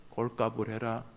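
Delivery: low, soft contempt; quiet inner sneer. Retold or imitated. Inner voice scorning the one who prays, low. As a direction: mutter it as your own conscience. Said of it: "내 안에서 들려요".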